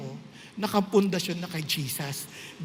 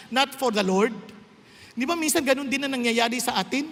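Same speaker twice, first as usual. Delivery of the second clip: ordinary talk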